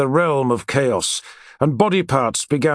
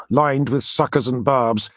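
plain reading voice

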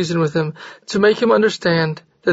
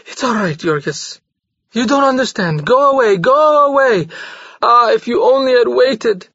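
exclaimed; 5 semitones higher than usual. A narrator's reading. Maria pleading with Jorgis to leave, exclaiming. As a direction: beg him to go. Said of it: "she exclaimed".

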